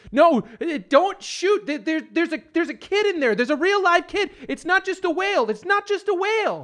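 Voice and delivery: frantic high plea. Acted. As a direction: beg them not to shoot. Frantic shout to soldiers.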